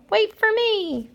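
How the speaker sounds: high, slow call after friends. Acted. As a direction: call out to the group, stretched. Animal calling after the group.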